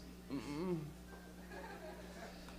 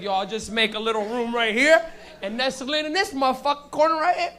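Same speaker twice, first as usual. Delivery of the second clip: voicing the burger; 3 semitones higher, 5 dB louder than usual. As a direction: play the smug burger settling in. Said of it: "McDonald's is in your intestines like".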